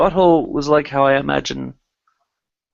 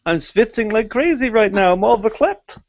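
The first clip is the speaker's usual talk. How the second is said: higher Yiddish kvetching; Yiddish accent; 7 semitones higher than usual. Pretend.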